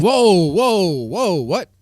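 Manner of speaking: loud, startled string of whoas; shocked interjection. Real, not acted. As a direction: cut in loudly, taken aback.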